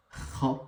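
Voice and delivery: "Kha" with a rasped back-of-throat fricative; Arabic kha. Shown as a demonstration. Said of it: "ça se prononce". (« Kha » avec un kh raclé, à l'arabe)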